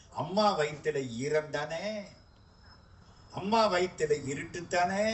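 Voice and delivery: ordinary talk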